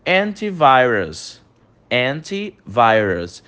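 slow, careful English antivirus; English model pronunciation. Shown as a demonstration. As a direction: say antivirus slowly for the class to repeat. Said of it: "Tudo que eu falar em inglês, você vai repetir a pronúncia". (pronúncia lenta em inglês de antivirus)

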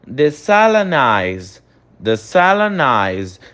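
desalinize said slowly; slow English pronunciation. Shown as a demonstration. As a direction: model desalinize for repetition. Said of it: "você vai repetir a pronúncia". (desalinize devagar em inglês)

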